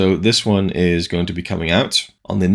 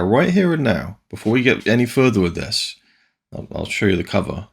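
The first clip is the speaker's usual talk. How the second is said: normal talking voice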